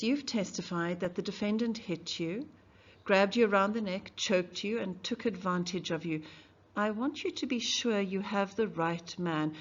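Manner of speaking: ordinary delivery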